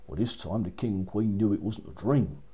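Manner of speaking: usual speaking voice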